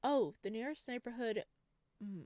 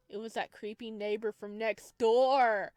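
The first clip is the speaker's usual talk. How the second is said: ordinary talk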